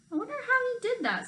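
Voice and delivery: high, fast amused wonder; a grandmother puzzling aloud, high and quick; Grandma marvelling at Harry's trick, high and fast. A narrator's reading. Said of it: "said Grandma".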